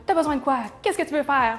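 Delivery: hammed-up brusque interrogation; pushy mock questioning. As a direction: act out the wrong way to greet someone in distress. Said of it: "Pas d'arriver en disant".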